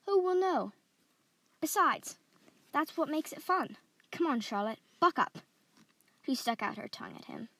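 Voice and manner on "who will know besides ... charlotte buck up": higher, louder, egging her on; daring boy. Acted. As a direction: coax a nervous cousin into mischief. Boy eggs on hesitant cousin.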